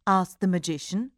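ordinary delivery, nothing marked

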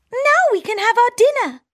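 high squeaky mouse, quick and cheerful; town mouse voice. Acted. Town mouse urging her friend to eat, fast high squeak. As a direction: rush on to dinner.

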